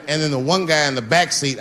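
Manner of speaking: ordinary delivery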